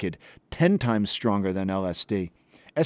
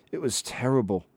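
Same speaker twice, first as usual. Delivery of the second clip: ordinary delivery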